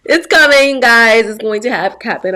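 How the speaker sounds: loud burst of confidence; excited declaration. Real, not acted. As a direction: call it out to the fans, excited. Excited about her dream role.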